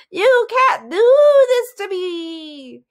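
long, drawn-out high shriek; loud, stretched villain shriek. A narrator's reading. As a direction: wail it in outraged horror. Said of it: "he shrieked".

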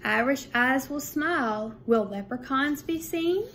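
lively sing-song picture book reading; bouncy storytime voice with a lifted refrain; reading to children. A narrator's reading. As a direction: read the rhymes aloud to a second grade class. Reads picture book to class.

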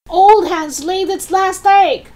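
high-pitched crowing holler; shouting. Acted. Grandma jeers at George's hen, high shout. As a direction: call it down from the roof. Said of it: "Grandma shouted".

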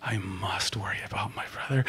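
hushed, breathy commitment; soft whispered vow; whispered resolve. Real, not acted.

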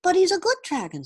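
put-on high, eager young boy voice; pleading boy. A narrator's reading. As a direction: plead for the dragon like a child.

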